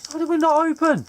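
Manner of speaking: very high, loud disbelieving cry; loud, high-pitched impatience; exasperated. Real, not acted. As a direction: squeal it in frustration. Impatient at slow card opening.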